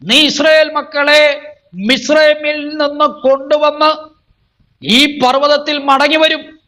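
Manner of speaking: high, declaiming sermon voice; fervent preacher's declamation; full-force preaching. Acted. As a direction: proclaim it to the congregation on a high, sustained pitch.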